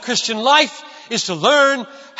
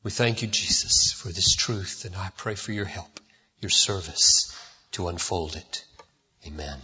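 prayer; 9 dB quieter than usual. Acted. Pastor praying softly to God for the congregation, low and hushed. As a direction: pray quietly to God before preaching.

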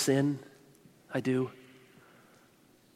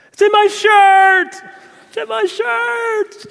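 put-on very high, loud panicked child's scream; 12 dB louder, slower than usual. Three-year-old screaming to his mother about a bee, panicked shriek. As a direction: shriek it like a terrified toddler. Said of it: "starts screaming".

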